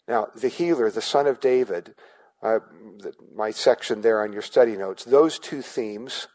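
ordinary talk

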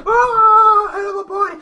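high, loud cry; frenzied shout. Acted. An orc cries out about having a body. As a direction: bellow it out in frenzy.

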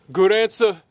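loud mock Boston catchphrase; Car Talk Boston accent. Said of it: "guys from Car Talk".

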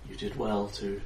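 tired distant fairy queen. Acted. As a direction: speak as a weary queen drifting off, far away. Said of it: "She sounds very tired and very far away".

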